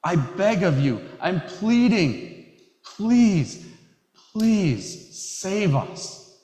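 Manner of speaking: a congregation's cry for rescue, pleading with rising urgency; urgent pleading. Shown as a demonstration. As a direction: beg harder and harder, then stretch out "please save us". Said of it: "definite sense of urgency, intensity".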